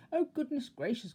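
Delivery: high, loud panicky cry; frightened cry. A narrator's reading. Mister Jelly hearing crackling cornflakes, high frightened cry. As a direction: squeal it in fright. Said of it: "cried Mister Jelly".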